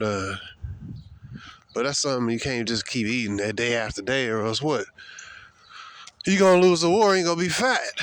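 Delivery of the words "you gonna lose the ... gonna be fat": higher and louder on "lose the war"; emphatic warning. Real, not acted. Warning viewers about junk food, loud emphatic preaching. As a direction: hammer the warning home.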